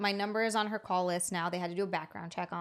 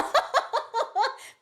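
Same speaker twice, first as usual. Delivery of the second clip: loud real laugh, pitched way up; high burst of laughter; 4 dB louder than usual. Real, not acted. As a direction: crack up laughing. Reacting to a funny childhood story.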